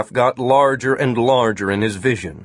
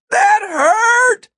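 high, loud shouted complaint; pained, indignant shout; angry yell; 6 dB louder than usual. A narrator's reading. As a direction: yell it up at someone far above. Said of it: "He yelled up at them".

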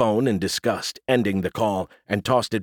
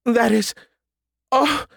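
high, broken-off gasps of shock; scandalised, pitched way up; flustered Southern lady; 13 semitones higher, 3 dB louder, slower than usual. A narrator's reading. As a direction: splutter in a high genteel voice. Southern lady flustered by lewd remark.

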